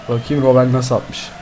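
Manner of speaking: ordinary delivery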